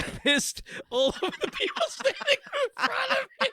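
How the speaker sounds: real laughter breaking through, pitch climbing; laughing. Real, not acted. Confessing a drunk concert story to co-hosts, laughing. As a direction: crack up until the voice goes high.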